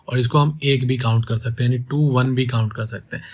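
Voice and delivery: ordinary talk, nothing marked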